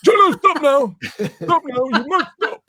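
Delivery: very high, loud mock scolding; Jeanette impression. Host mimicking co-host objecting before poem of the week, hammed-up impression. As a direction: put on an exaggerated high voice and odd accent, imitating her. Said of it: "I don't know what that accent is".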